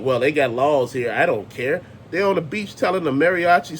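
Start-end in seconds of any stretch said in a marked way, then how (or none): 0.0-1.8 lightly mocking tourist. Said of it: "And the people like".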